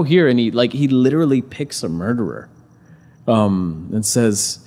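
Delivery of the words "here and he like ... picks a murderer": normal talking voice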